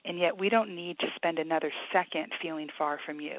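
soft, earnest prayer voice, quiet and steady; praying over a hurting caller; heartfelt prayer. Real, not acted. Author prays for struggling caller, softly earnest.

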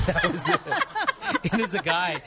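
high, slow, laughing through the words. Real, not acted. Laughing at the punchline.

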